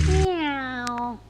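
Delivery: high, bored cat meow; a flat cat's meh; unimpressed meow. A narrator's reading. Wilbur the cat unimpressed by the dog, high bored meow. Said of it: "He didn't sound very impressed".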